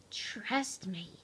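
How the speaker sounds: very soft, low near-whisper; hushed assurance. A narrator's reading. Pipsqueak reassures Chuckles and Mo, hushed and low.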